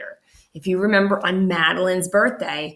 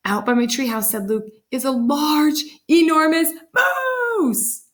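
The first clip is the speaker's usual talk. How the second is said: put-on excited boy; 8 semitones higher than usual. Boy tells his mother about the moose, getting excited. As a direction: build up to the big moose.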